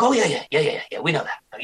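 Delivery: quick high burst of mock agreement; eager agreement. Marines agreeing with an actor about sleep loss, emphatic agreement. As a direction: imitate knowing, emphatic agreement. Quoting the Marines' knowing reply.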